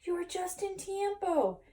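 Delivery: high, lightly put-on character voice; lightly voiced sidekick. A narrator's reading. Don Diego greeting Skippito in the crater, lightly voiced sidekick.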